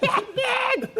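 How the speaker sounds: high-pitched mimicked cackle; high mock laughing fit; imitated hysterical laugh. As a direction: mimic someone dying laughing in the back. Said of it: "dying laughing".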